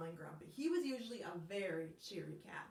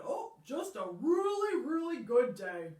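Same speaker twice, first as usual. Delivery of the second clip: higher, louder cheery frog; happy Frog; 3 semitones higher, 6 dB louder than usual. Acted. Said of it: "And Frog said".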